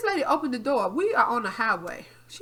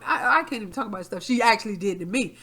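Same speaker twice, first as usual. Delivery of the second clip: ordinary delivery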